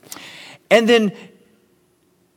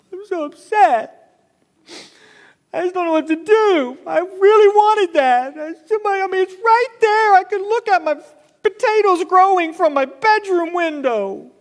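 much higher, whiny pouting voice; whiny king; 9 semitones higher than usual. Acted. Pastor acts out King Ahab sulking over the vineyard, high and whiny. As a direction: whine like a spoiled child denied a toy. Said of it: "I mean, just this whiny".

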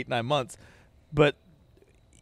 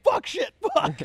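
very high-pitched hammed-up cursing; mock swearing burst; slower than usual. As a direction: curse like it all went wrong. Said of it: "It's like".

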